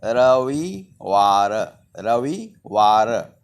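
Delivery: slow, drawn-out "Ravivar", syllable by syllable; pronunciation demo. Shown as a demonstration. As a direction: say "Ravivar" slowly with long, separated syllables. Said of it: "the pronunciation is".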